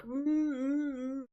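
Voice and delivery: very high-pitched humming "mm"; squeaky-high mock hum of delight; imitated marshmallow noise. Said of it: "The marshmallow noise is fantastic".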